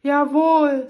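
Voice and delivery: höher und lauter, strahlendes „Jawohl“; gut gelaunt. Acted. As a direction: freudig und entschlossen zustimmen. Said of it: "sagte die Prinzessin gut gelaunt". (fröhlich bestätigt)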